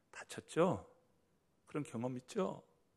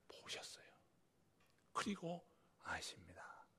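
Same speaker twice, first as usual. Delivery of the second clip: whisper; 6 semitones higher, 11 dB quieter than usual. Real, not acted. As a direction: drop to a breathy hush. Preacher drops to a whisper. (속삭이듯)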